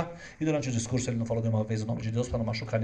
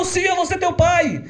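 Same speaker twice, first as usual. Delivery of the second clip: higher, louder slow promise; emotional shout; 7 dB louder, slower than usual. Acted. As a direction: rabbi crying out his promise. (rabino prometendo em voz alta)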